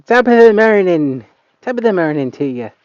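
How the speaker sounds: loud, high mock Irish brogue; joking about tourists, loud mock Irish accent. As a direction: ham up the Irish accent as a joke. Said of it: "nobody actually says that here".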